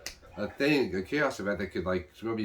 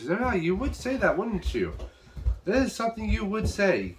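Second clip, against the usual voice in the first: mocking taunt; 10 semitones higher than usual. Mocking reply quoted in recap.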